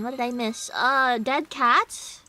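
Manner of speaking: ordinary talk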